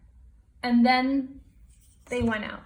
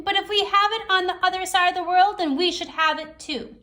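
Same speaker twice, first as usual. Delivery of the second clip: high, louder Raven voice; a bold bird calling everyone together; Raven's voice; 7 semitones higher, 4 dB louder than usual. Acted. Raven tells the gathering about fire, high and bold. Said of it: "said Raven".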